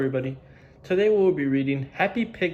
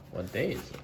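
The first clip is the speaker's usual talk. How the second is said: usual speaking voice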